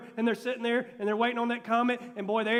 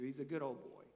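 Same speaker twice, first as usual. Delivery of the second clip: hushed aside; 9 semitones lower, 16 dB quieter than usual. Real, not acted. Aside, dropped low.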